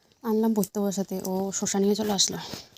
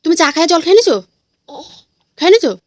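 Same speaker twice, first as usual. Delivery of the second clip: loud high asking; 8 semitones higher, 11 dB louder than usual. Real, not acted. Mother calling to her toddler about the water, high and loud.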